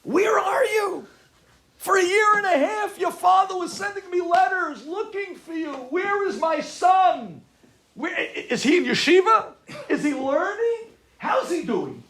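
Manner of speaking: high, shouted barrage of questions; going crazy. Retold or imitated. Uncle confronting runaway nephew about yeshiva, frantic high shouting. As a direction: shout like a frantic, accusing relative. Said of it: "My uncle was going crazy on me".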